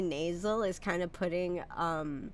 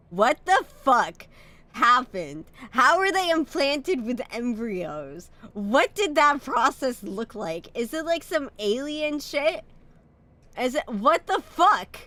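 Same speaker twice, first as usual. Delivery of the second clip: shocked; 5 semitones higher, 6 dB louder than usual. Real, not acted. Shocked reaction to game text.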